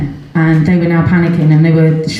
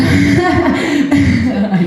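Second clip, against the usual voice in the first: real laugh; 8 semitones higher than usual. Real, not acted.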